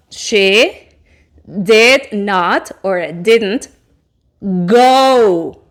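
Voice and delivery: very slow, deliberate English demo; slow careful model. Shown as a demonstration. Teacher slowly dictates the negative form to students. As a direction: stretch each word for learners.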